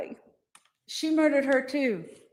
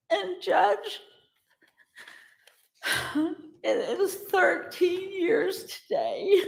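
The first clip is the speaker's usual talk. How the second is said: tearful; 7 semitones higher than usual. Real, not acted. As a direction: voice breaking with tears. Tearful victim statement.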